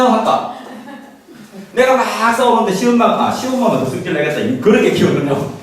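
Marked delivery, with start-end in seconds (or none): none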